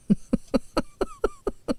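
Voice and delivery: a high, breathy burst of laughter; real laugh, high and airy. Real, not acted. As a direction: laugh at your own punchline. Laughing at her own joke.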